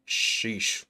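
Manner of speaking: ordinary delivery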